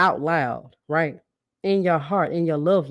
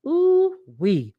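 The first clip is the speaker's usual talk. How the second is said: excited whoop; 10 semitones higher, 5 dB louder than usual. Real, not acted. Reacting to her own point.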